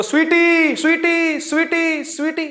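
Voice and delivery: calling a name into the distance, fading each time; echoing call of a name; echo demo. Shown as a demonstration. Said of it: "जैसे मान लो हमने बोला".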